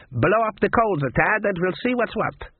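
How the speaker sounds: high, lilting voodoo woman. A narrator's reading. Voodoo woman instructing the cook, high character voice.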